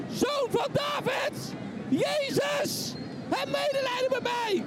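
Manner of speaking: long, high, slow cry for mercy; desperate shout. Acted. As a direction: call out across the crowd, pleading. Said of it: "En hij roept het uit". (wanhopig uitroepen)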